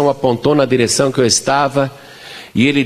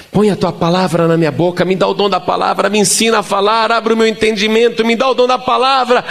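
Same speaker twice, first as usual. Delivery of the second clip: rising fervent prayer; 8 semitones higher than usual. Retold or imitated.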